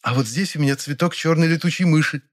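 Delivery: high, proud bragging; boastful. Acted. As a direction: show off each flower. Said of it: "похвасталась она".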